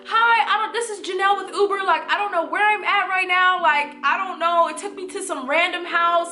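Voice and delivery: pleading with a stranger for directions, lost and scared; frightened lost driver on the phone; panicky call. Retold or imitated.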